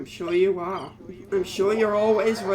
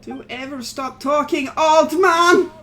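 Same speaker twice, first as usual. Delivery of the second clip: yelling; 5 semitones higher, 10 dB louder than usual. Acted. Yelling at the old man.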